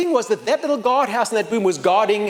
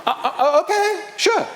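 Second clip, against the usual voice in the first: high, halting mock-nervous agreement; stammer, then agree in a high voice; 11 semitones higher than usual. Traveler agreeing to draw an armed soldier, nervous stammer.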